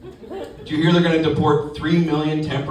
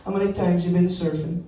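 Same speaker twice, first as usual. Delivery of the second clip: Caribbean rental guy. Acted. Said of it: "Best thing about being down there".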